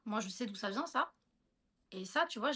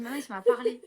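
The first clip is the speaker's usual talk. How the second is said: insistent; 9 dB louder than usual. Retold or imitated. Insisting the cat spoke.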